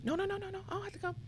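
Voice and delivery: high, soft, bashful no-no-no; lightly played shy guest declining; mock polite refusal. To the woman inviting her in, a high, soft mock refusal.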